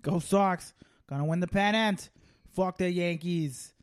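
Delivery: mock Boston sports fan; Boston accent. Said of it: "Not the, not the worst accent".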